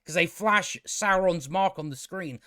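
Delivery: usual speaking voice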